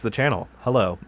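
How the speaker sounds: ordinary talk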